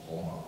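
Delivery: "homage" said oh-MAHZH, French style; French pronunciation. Shown as a demonstration. Preacher reading that David paid homage, "homage" in French pronunciation. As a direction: end "homage" on a French "ahzh", H silent. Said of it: "Let's go French".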